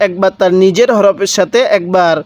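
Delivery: ordinary talk, nothing marked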